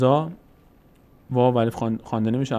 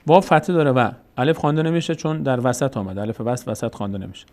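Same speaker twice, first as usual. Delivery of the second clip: ordinary delivery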